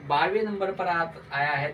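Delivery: normal talking voice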